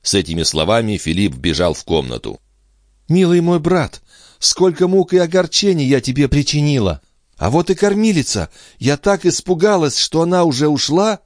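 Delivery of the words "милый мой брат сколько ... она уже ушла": high-pitched, agitated sister; emotional young mother. Acted. Andrea greeting her returning brother, high and agitated. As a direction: pour it out high and excitedly.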